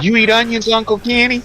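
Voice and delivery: high, eager voice for a nephew; Kyle. Retold or imitated. Nephew asking his uncle about onions, imitated in a high voice. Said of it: "he'll be like".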